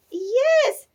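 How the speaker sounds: high, loud little yes; guilty squeak. A narrator's reading. Bridget admits guilt.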